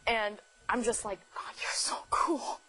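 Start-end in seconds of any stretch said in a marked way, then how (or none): 1.3-2.6 breathless whispered awe, slow; under breath. Retold or imitated. Guest sitting beside her crush whispers in awe. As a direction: whisper it to yourself, star-struck. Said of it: "Under my breath".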